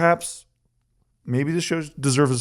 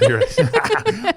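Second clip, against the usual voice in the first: high-pitched, slow, conceding through laughter; laughing; 3 dB louder, much slower than usual. Real, not acted. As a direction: giggle the admission out. Admits fault, cracking up.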